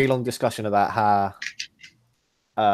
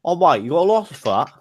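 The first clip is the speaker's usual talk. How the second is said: higher, mumbled mock-British challenge; Cockney accent; 5 semitones higher than usual. Friend told how every British person sounds, mock Cockney accent. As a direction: ham up the Cockney licence line. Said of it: "That's the one".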